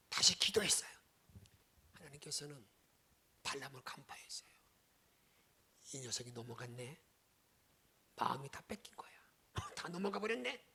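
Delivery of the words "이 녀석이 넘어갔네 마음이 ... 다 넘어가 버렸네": low, whispered voice of God seeing through him; a hint of a low knowing whisper; hushed knowing God. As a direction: murmur it like a knowing judge.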